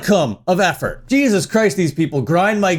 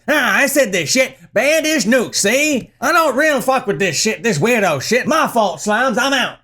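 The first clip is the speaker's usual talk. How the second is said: mock gangster patter, pitched up; thirties gangster; 3 semitones higher than usual. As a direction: do a hammed-up 1930s mobster. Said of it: "Are you a fucking gangster from the thirties".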